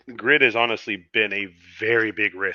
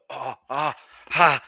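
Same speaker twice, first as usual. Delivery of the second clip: pained groans from a cramping hamstring; wincing through a hamstring cramp; 4 semitones higher, slower than usual. Real, not acted. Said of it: "sorry, my hamstring's cramping".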